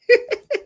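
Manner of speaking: short bright burst of laughter; quick high laugh. Real, not acted. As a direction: laugh when greeting a viewer. Laugh greeting a viewer.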